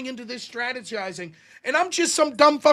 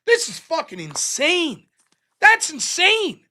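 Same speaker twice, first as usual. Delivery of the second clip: slow, high-pitched disbelief; stretched-out, exasperated outburst; incredulous; 5 semitones higher, slower than usual. Retold or imitated. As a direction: re-enact being appalled, building higher. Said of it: "so incredulous about it".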